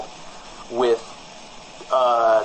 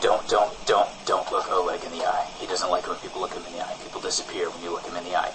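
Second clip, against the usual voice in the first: nervous hushed warning; 7 semitones lower, 5 dB quieter, faster than usual. Acted. Nervous warning about Oleg.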